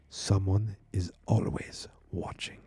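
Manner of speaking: slow whisper, barely voiced; whispered warning. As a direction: breathe it out like a secret. Kid repeats the warning ominously.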